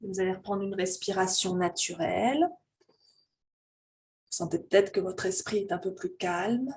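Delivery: slow, calm voice leading a breathing meditation; guided meditation. Real, not acted. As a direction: guide them gently into their breath, unhurried, drawing out phrase endings.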